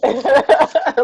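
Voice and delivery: loud, high laugh; cracking up at the story; loud burst of laughter. Real, not acted. Friend laughing hard at the plan, loud laugh.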